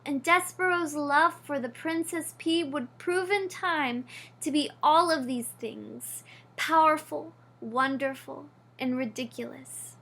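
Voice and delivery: ordinary delivery, nothing marked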